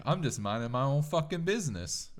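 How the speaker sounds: hammed-up Italian tough guy; Italian accent. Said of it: "the voice I do when it's like".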